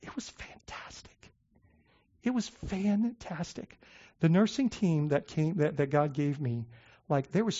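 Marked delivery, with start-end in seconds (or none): 0.0-1.3 slow, near-tearless whisper, fighting tears; choked whisper. Real, not acted. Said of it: "I'll try not to cry". 2.2-3.8 slow, soft and emotional, softer than usual; voiced through tears. Real, not acted. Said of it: "I'll try not to cry".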